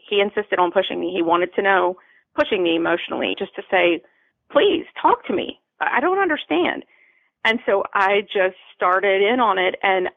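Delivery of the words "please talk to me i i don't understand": husband's plea pitched high up; a high, pleading voice for the worried husband. Retold or imitated. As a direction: beg her to talk, voice lifted high. Voicing husband's pleading request.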